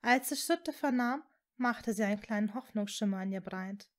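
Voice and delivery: ordinary delivery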